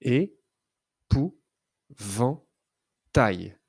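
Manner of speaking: é-pou-van-tail, very slow, syllable by syllable; teacher segmenting a word for a child; syllables drawn out. Shown as a demonstration. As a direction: stretch Épouvantail into its four syllables. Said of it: "Combien de syllabes entends-tu?".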